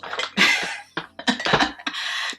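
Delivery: cracking up mid-story; helpless, drawn-out laugh; real laughing fit. Real, not acted. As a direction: burst out laughing, unable to finish. Cracks up quoting his compliment.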